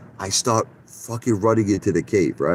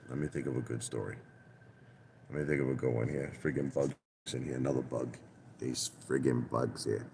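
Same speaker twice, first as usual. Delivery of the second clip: much softer, lower aside; muttering to himself; 7 semitones lower, 17 dB quieter than usual. Real, not acted. Vlogger hunting for a story, distracted by bugs, muttering. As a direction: think aloud under your breath, distracted by bugs.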